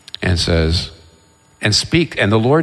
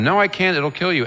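higher-pitched retold protest; arguing back; 9 semitones higher than usual. God turning down Moses once more, arguing back. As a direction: act out a mildly exasperated refusal. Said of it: "gets into an argument with God".

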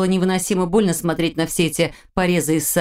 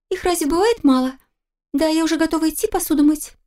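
put-on much higher, giggly teenage girl; girlish voice; 11 semitones higher than usual. A narrator's reading. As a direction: tease it like a mischievous daughter. Daughter answers father.

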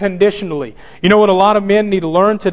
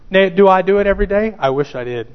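usual speaking voice

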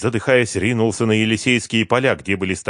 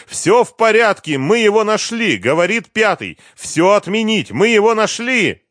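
high, loud, exultant yelling; full-voiced, urgent cries; triumphant shout; 14 semitones higher, 8 dB louder than usual. A narrator's reading. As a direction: shout the good news down the phone. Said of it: "набрал номер и закричал".

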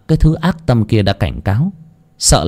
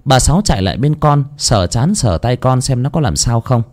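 plain reading voice

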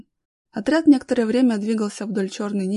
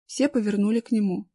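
ordinary delivery

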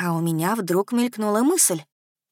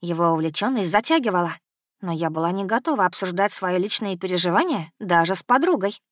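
plain reading voice

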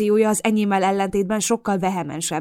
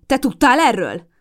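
loud, high heated exchange; loud quarrelling; heated quarrel; 5 semitones higher, 7 dB louder, slower than usual. A narrator's reading. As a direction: fire the accusations. Heated accusation and denial.

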